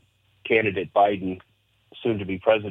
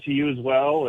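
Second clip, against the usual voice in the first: normal talking voice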